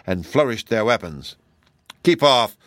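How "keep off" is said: loud, firm warning. A narrator's reading. Tin Woodman defending his friend from the beast, warning. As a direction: order it back loudly, pitch slightly raised. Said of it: "said the Tin Woodman warningly".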